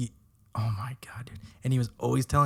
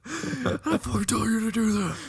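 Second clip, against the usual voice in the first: much higher, strained mock scolding; mock yell at spoon; 11 semitones higher than usual. Telling off a spoon like a dog, much higher mock scold.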